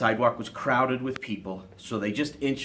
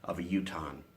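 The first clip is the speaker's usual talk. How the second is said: plain reading voice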